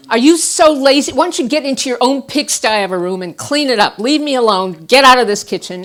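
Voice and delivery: loud, high, furious tirade; angry scream. Retold or imitated. Wife ordering husband out of kitchen, furious shout. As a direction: rage at him, throw him out.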